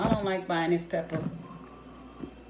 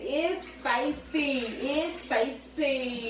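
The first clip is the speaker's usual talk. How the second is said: put-on slow, higher whiny kid voice; imitating the kids; 4 semitones higher, much slower than usual. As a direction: drag out "It's spicy" like a complaining child. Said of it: "'Cause they say".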